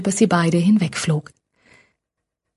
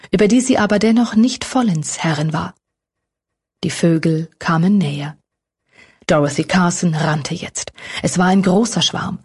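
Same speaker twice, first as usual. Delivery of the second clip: usual speaking voice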